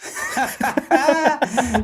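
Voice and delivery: high burst of delighted laughter; big laugh. Real, not acted. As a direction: break out laughing at the joke. Laughs at Tommy Wiseau casting.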